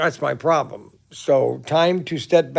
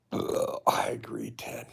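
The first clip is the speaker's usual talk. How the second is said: breathy whisper to Ted; whispered aside; 9 dB quieter than usual. Real, not acted. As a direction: murmur it under breath. Unvoiced aside.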